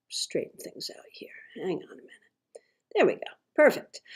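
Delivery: barely audible murmur to yourself; whispered aside. Real, not acted. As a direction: whisper it under your breath while fixing something. Muttered aside while adjusting the stream.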